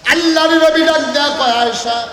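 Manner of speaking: high, loud sustained call, easing on the name; full-force preaching. A narrator's reading. Preacher telling how the Prophet called Aisha, full-force preaching. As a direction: proclaim it to the whole crowd.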